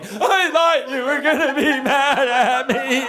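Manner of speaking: high, slow imitation wail; hammed-up weeping kid; mock child sobbing. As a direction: stretch it into a fake bawl. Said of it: "She's sobbing".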